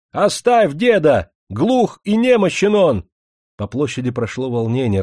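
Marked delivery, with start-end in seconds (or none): 0.1-3.0 high, loud, drawn-out demand; threatening shout. A narrator's reading. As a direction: shout a slow, menacing warning. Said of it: "они угрожающе кричали".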